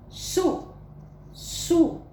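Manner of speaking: SUZANA as high SU, pause, ZA, pause, NA; strong S on SU, syllables separated. Shown as a demonstration. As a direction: sound out SU, then SUZANA in three. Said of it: "tem um som forte".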